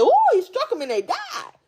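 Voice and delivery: high, gasping shock; shocked ooh. Retold or imitated. Preacher recalling her shock at reading about David. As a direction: react like it just hit you.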